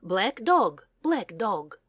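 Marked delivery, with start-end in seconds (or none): none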